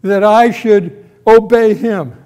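high, loud defiant ruler's question; Pharaoh's challenge. Acted. Pharaoh questions why he should obey Yahweh, defiant. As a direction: throw it out loudly as a scornful challenge. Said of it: "Pharaoh has said to you".

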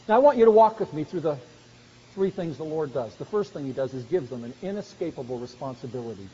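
ordinary delivery

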